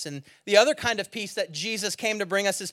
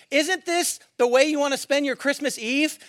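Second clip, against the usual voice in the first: higher, louder mock exasperation at the fighters; exasperated inner rant; 9 semitones higher, 5 dB louder than usual. Preacher mocking fighters on the train, exasperated and higher. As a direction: vent at them in disbelief, pitched up. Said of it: "And I'm thinking".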